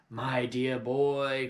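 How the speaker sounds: very slow, drawn-out grand exclamation; a touch of a pompous father; crying out. Acted. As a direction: savour each word like a showman. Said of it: "cried Mister Killycranky".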